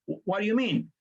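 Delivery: ordinary delivery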